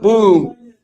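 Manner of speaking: sudden loud "Boom" as the trade closes. Real, not acted. Stop loss hit.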